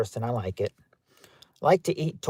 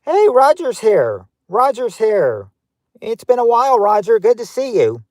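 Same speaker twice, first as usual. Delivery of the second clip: excited greeting; 8 semitones higher, 7 dB louder than usual. Real, not acted. Streamer happily greets returning viewer Roger, loud and high.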